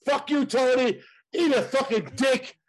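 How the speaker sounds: high-pitched, indignant insult; worked-up banter, pitched well up; heated cursing. Real, not acted. Heated mock quarrel between co-hosts.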